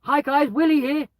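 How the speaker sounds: high, loud, slow cheery greeting; cheesy intro. Shown as a demonstration. Greeting a group of strangers, high and hammed-up. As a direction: ham it up like a game show host. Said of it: "hope and pray there's no kids around".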